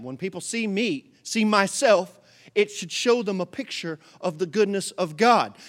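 usual speaking voice

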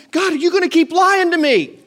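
high, loud acted protest; indignant challenge. Preacher paraphrasing Jeremiah's complaint to God, in a loud indignant protest. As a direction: cry out as if confronting God.

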